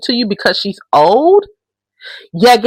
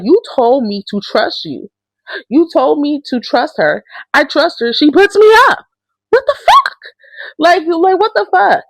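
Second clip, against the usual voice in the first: imitating upset outburst; 7 semitones higher than usual. Mallory confronting Barry after being put up, climbing mock outrage. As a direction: mimic her pitch jumping up in disbelief. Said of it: "and she's like".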